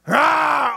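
high playful roar; mock bear roar. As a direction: roar out like a kid playing bear. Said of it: "you were growling at everybody".

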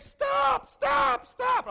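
very high, loud panicked shouts; frantic full-voice yell. Retold or imitated. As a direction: scream each word to halt a car. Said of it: "he's yelling".

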